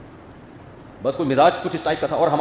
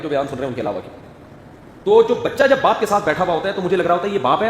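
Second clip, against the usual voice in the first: ordinary delivery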